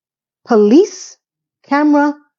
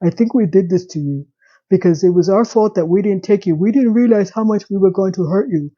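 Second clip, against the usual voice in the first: lightly voiced emotionless drone; imitating a flat, emotionless apology; monotone. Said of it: "just her monotone tone".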